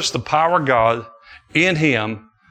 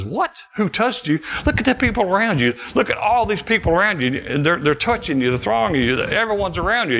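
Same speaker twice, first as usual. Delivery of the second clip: incredulous disciple; 8 semitones higher than usual. Acted.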